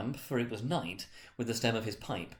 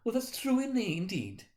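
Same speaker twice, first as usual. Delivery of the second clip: put-on much higher, meek old woman voice; timid laundress; 12 semitones higher than usual. Mrs Dilber eagerly agrees with the charwoman, meek. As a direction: agree nervously in a thin voice.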